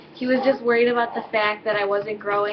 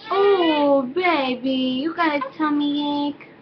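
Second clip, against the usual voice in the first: sympathetic cooing, higher pitched; 5 semitones higher, slower than usual. Real, not acted. Soothes her fussing baby.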